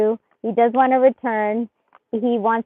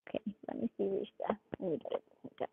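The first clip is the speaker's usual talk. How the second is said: much softer, partly whispered pleas; murmured requests; 2 semitones lower, 11 dB quieter than usual. Real, not acted. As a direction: coax the cards quietly.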